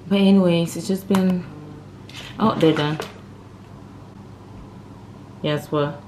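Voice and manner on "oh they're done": ordinary talk, nothing marked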